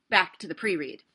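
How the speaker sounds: ordinary delivery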